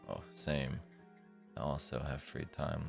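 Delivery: ordinary delivery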